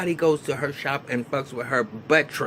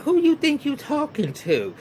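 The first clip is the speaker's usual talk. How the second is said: mock indignant challenge; 13 semitones higher than usual. Retold argument, Miss Pat snaps back.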